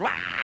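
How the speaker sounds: loud, sustained "waah" yell; retold scream back. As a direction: imitate screaming back at someone. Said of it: "she screamed and I went".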